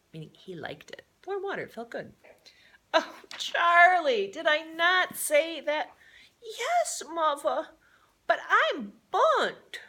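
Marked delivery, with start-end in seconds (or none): 2.9-5.9 high, loud, slow dismayed cry; a mother aghast at her child; agonized mother. Acted. Mother finding her son in the tub, dismayed. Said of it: "the agonized mother". 6.4-9.8 put-on high, slow child voice; a little boy admitting it; small boy's reply. Charlie answering his mother, high child voice. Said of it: "the agonized mother".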